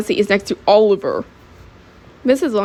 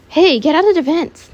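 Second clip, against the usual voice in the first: high-pitched call to a student; alarmed scolding teacher; teacher's order; 8 semitones higher, 4 dB louder than usual. Acted. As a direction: order the kid out, pitch raised. Teacher orders child out of vent.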